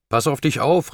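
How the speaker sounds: high, urgent call of warning. A narrator's reading. Julie to John as they split up, calling out. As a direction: call after someone with concern. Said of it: "rief Julie".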